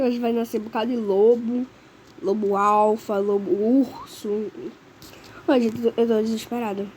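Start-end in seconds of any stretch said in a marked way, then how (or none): none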